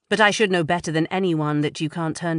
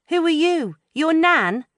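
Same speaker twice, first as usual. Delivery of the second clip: much higher, louder teasing; high mocking teenage jab; mock scorn; 12 semitones higher, 5 dB louder than usual. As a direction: tease her like a cheeky teen. Teasing friend's phrase.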